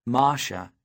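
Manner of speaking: ordinary delivery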